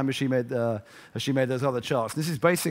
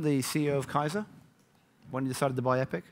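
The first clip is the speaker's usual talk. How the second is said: usual speaking voice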